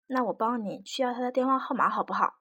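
ordinary delivery

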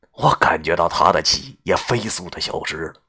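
ordinary delivery, nothing marked

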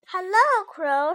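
loud, high storybook greeting; shouted hail to someone passing. A narrator's reading. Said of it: "shouted Toad".

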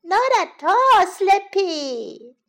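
put-on high, loud, drawn-out child voice; little bunny. A narrator's reading. Little bunny refusing sleep to Mummy, high slow protest. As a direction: protest slowly like a wilful child. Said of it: "said little Bunny".

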